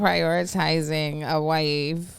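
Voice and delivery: ordinary talk, nothing marked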